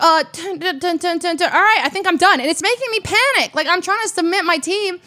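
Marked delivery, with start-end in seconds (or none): 0.0-2.4 higher, rapid mock dun-dun spam; imitating Abe rushing. Abe spamming his team late at night, mock rushed. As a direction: rattle it off like hasty typing. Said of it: "He's like".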